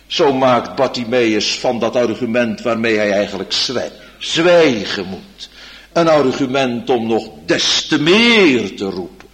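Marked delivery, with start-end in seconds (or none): none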